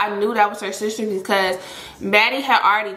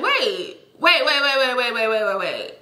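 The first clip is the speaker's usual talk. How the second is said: retold stop-right-there, words tumbling together; retold rapid-fire interruption; 2 semitones higher than usual. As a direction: cut in with a run of waits. Halting him on hearing his denial.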